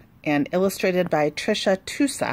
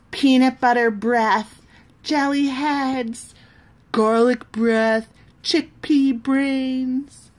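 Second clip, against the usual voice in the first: higher, louder, slow taunting chant; kids taunting; 7 semitones higher, 6 dB louder, slower than usual. Acted. Lunchroom kids insulting the other side, slow loud taunts. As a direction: sneer each insult out.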